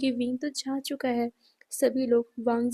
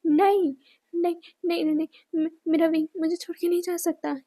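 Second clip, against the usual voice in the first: shouting in denial; 4 semitones higher, faster than usual. A narrator's reading. Man shouting over his dead lover's body. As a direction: yell it out refusing to believe. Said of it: "वो चिल्लाते हुए कहता है".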